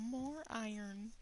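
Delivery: high, slow squeal of greed; squeaky greedy voice. Pretend. Greedy trade demand.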